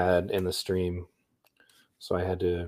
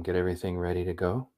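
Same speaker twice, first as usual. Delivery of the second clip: usual speaking voice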